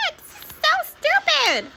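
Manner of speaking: very high, drawn-out squeal; exaggerated high-pitched protest, slowly stretched; protesting student. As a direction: whine it out in a put-on squeaky voice. Audrey protests in story.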